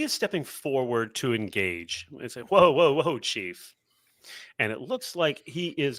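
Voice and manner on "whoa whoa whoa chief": lightly voiced Cajun man; a hint of Cajun twang, louder start; Cajun accent. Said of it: "in a Cajun accent".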